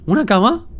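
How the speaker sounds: pleasantly shocked at the find; surprised. Real, not acted. Gamer spotting a bed in the wreck, high and surprised.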